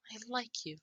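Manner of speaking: put-on high, soft child voice; little kid. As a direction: say it small and high, like a young child.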